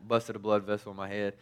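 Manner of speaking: ordinary delivery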